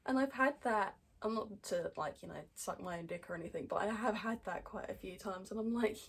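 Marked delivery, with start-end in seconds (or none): none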